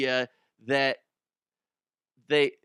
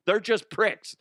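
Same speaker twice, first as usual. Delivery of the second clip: "They're just pricks" blurted higher and louder; higher, louder first take of "They're just pricks"; punchy punchline; 9 semitones higher, 4 dB louder than usual. Acted. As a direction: fire the punchline up high. Joke about New Yorkers, punchline.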